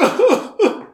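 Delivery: high put-on laugh; demonstrated laugh. Shown as a demonstration. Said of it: "was it that kind of laughter?".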